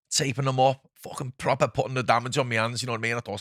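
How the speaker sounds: normal talking voice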